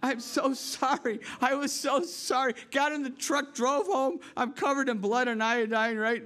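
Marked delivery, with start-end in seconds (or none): 0.0-2.5 higher, slow mock-remorseful pleading; a touch of tearful sorry; retold apology. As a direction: re-enact a guilty kid apologising to the pig. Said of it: "I just remember saying".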